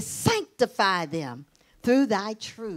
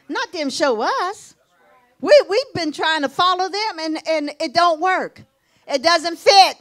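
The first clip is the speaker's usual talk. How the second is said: raised, emphatic sermon voice; loud, higher-pitched preacher's exhortation; fired-up preaching; 6 semitones higher, 6 dB louder than usual. Acted. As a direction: drive the point home to the congregation. Preaches to congregation.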